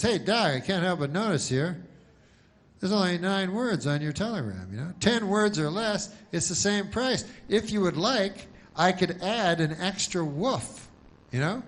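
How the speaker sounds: a polite counter clerk upselling a customer; telegram clerk. Pretend. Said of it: "And he says to the dog, he goes".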